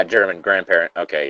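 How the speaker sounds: ordinary talk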